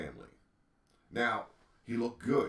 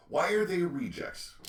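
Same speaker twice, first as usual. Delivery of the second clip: much higher and louder, stress on are; indignant shout; 10 semitones higher, 6 dB louder than usual. Real, not acted. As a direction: demand the answer, raised voice. Rhetorical question repeated louder.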